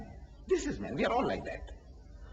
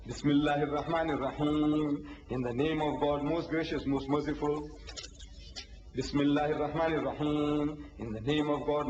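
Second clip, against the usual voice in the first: low, measured chant on a level tone; sacred recitation; 4 semitones lower than usual. Shown as a demonstration. Speaker demonstrating the opening of each chapter, solemnly intoned.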